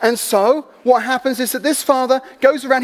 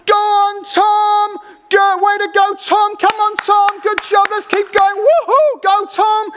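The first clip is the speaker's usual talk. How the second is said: crowd cheering; 8 semitones higher, slower than usual. Retold or imitated. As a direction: cheer him on like a roadside crowd. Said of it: "they're cheering and they're screaming".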